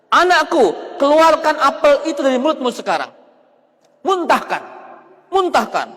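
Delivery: a father snapping at his son; stern command. Acted. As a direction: order the child to spit it out. Father scolds son over apple.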